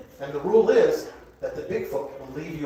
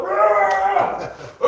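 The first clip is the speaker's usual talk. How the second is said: struggling roar; 3 semitones higher than usual. Acted. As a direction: roar as if straining hard. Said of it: "a redneck in a struggle".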